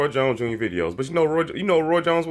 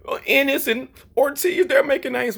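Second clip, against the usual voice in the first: mocking Charlo; 12 semitones higher than usual. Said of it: "Jermell Charlo went from".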